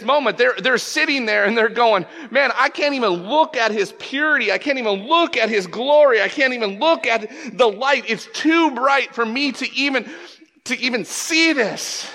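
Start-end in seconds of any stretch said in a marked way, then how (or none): 2.3-12.1 high, insistent acted awe; overawed exclaiming. Sinless angels unable to look at God, overawed exclaiming. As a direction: exclaim it, overwhelmed by too much glory. Angels overwhelmed before God's glory.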